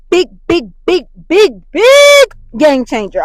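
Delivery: loud, high, stretched-out excitement; hyped up. Real, not acted. Raving to listeners about this episode, hyped and loud. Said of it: "I'm just hyped up".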